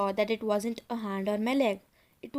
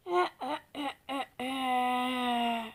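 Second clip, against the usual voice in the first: dry, weak, high-pitched croaking cackle; a laugh that's more a dry croak; shrill old cackle; 2 semitones higher than usual. A narrator's reading. Cursed mask makes the boy cackle like an old man. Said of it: "Another frightening cackle, in a shrill, high-pitched voice".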